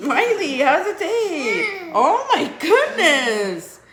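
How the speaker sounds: laughing delight. Real, not acted. Laughing at baby eating.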